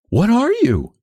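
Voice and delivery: put-on very high, girlish question; curious, high-pitched young woman; girl's question. A narrator's reading. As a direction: call down in a high feminine voice. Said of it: "she repeated".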